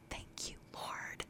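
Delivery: slow, breathy thank-you whisper under the breath; a private grateful aside to God; whispered prayer. Retold or imitated. Silent prayer at meeting.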